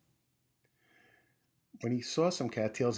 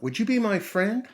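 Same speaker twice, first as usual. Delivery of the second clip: higher, louder hopeful call across the pond; 10 semitones higher, 7 dB louder than usual. Acted. Mouse calling to the frog on the rock, loud hopeful call. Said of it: "The field mouse called out".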